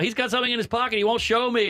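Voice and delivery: tattling niece. Retold or imitated. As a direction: tell on him to grandma.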